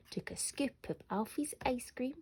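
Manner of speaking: ordinary delivery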